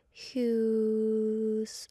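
ordinary delivery

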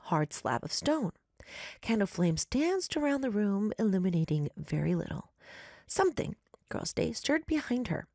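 story narration. A narrator's reading. Reads listener story aloud.

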